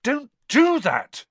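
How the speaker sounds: loud, high, drawn-out protest; yelled. A narrator's reading. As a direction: yell at whoever startled you. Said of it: "Harry yelled".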